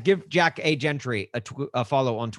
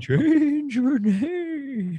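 much higher, very slow spooky wail; ghost wail; 13 semitones higher, much slower than usual. Acted. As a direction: stretch "Change" out like a haunting ghost. Ghostly spirit speaks to dead man's son.

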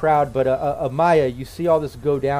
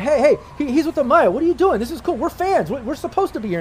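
much higher, louder fan voices; protesting fans; 11 semitones higher, 4 dB louder than usual. Acted. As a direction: crowd of fans pushing back at the guard. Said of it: "start grabbing on the security guard".

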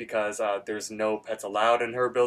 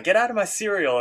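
pitched way up, an indignant yelp; mock angry Rigby; 10 semitones higher, 4 dB louder than usual. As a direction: play annoyed Rigby shooing ducks from his bowl. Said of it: "And then Rigby's like".

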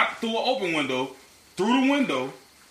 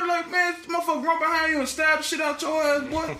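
warning yell; 13 semitones higher than usual. Retold or imitated. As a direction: yell the warning across the room. Continuing the retold warning.

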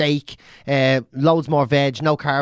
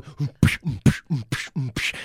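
mock beatbox; 3 semitones lower, 3 dB quieter than usual. Mocking the drummer beside the drum band, mock beatbox sounds. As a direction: mouth beatbox mm psh. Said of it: "some other prick beside them going".

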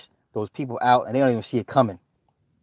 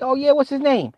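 chipper dad; 10 semitones higher than usual. Retold or imitated. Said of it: "I'll be like".

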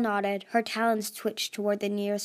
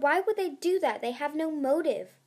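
high-pitched mild shout; 6 semitones higher than usual. A narrator's reading. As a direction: protest in a raised pitch. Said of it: "Tsunami shouted".

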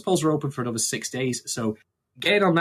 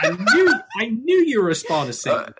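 higher, louder, laughing; laughing accusation; 8 semitones higher, 6 dB louder than usual. Pretend.

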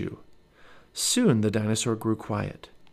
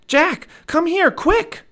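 very high, loud urgent call; 8 dB louder, slower than usual. A narrator's reading. Annie calls Jack over the hill, urgent and high. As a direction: call him over in piercing, stretched words. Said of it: "Annie called".

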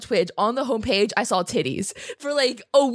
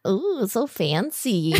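slow teasing ooh; playfully impressed, drawn out; mock awe; much slower than usual. Teasing the podcast intro.

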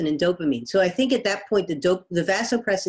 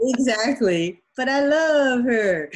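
lovestruck laugh; 5 semitones higher, slower than usual. Retold or imitated. Lovestruck man's reply, laughing.

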